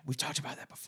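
breathy voiceless whisper. Pretend. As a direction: whisper it back playfully. Joking along about stage whispers.

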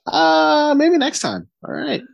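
awkward brush-off. Retold or imitated. Jack awkwardly brushed off fishing.

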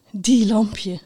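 hushed, fond murmur; to oneself. A narrator's reading. Knight shakes her head fondly, saying it to herself. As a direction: half-whisper it to yourself.

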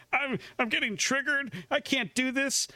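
high-pitched imitation of an upset drunk woman; mock losing it. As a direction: whine it up high, mock-distressed. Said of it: "she's just losing it".

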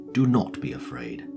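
normal talking voice

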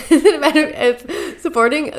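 helpless laughing at a botched accent. Real, not acted. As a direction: try to read on through real laughter. Laughing at own failed accent attempt.

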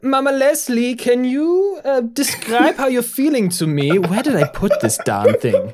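high, hammed-up German accent; fussy German physician questioning a patient; German doctor. German doctor examining Mama Leslie, high German accent. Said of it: "The German doctor".

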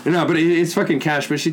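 ordinary delivery

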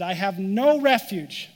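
slow, loud cry of no refuge; David crying out that he has no refuge; despairing cry. Retold or imitated. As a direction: stretch out the despair.

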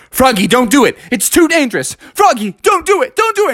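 high, urgent pleading; acted panicky crowd cries; alarmed warning. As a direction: beg him to stop, rising in pitch. Friends warn against the stunt.

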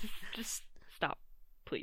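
breathy whispered begging; whispered plea. Acted.

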